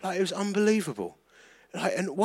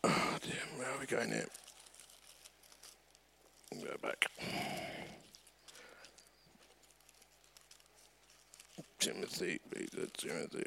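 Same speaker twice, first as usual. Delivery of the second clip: mumbling to himself; 7 semitones lower, 18 dB quieter than usual. Real, not acted. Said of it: "this Bible's too big".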